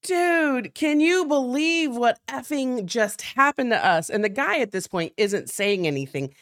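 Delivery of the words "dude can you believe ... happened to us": excited deputy. Retold or imitated. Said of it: "he's like".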